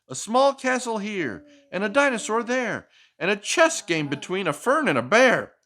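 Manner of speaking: lively picture-book read-aloud; reading to a child. Acted. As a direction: read it like a bedtime story for a little one.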